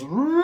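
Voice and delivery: high hooting siren imitation; a parrot howling "Roo" like a siren; parrot siren call. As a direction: mimic the bird copying a siren. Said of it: "and she goes".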